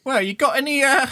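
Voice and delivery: very high, fast cheeky urchin; wheedling for coin; street kid voice. Acted.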